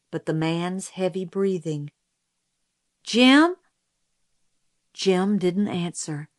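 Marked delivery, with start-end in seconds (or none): 3.0-3.6 high, loud rising question; searching call. A narrator's reading. Scout calling Jim after no answer, questioning call. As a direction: call the name, waiting for an answer.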